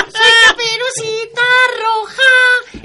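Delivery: put-on high, slow sing-song girl; little girl voice. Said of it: "él era Caperucita Roja".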